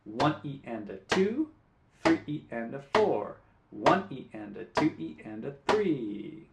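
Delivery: teacher counting sixteenth notes aloud in time; rhythm counting. Shown as a demonstration. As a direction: count the syllables steadily to the guitar. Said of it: "keep the beat while counting".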